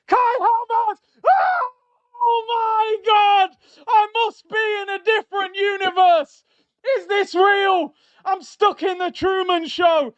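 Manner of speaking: real wild goal celebration; ecstatic screaming. Real, not acted. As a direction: scream like you can't believe what you just saw.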